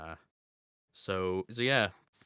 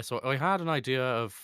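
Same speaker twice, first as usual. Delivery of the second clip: attempted Irish accent, louder; 5 semitones higher, 5 dB louder than usual. Shown as a demonstration. Switching through accents for fans, attempted Irish accent. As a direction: try a half-learned Irish lilt. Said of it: "just switching through accents".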